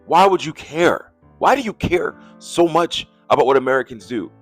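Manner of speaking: usual speaking voice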